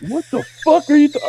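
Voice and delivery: high, disbelieving what the fuck; mock shock, voice jumping way up; incredulous squeak. Retelling a coworker's accusation, high incredulous outburst.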